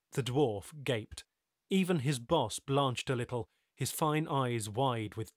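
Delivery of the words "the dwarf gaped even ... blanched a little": usual speaking voice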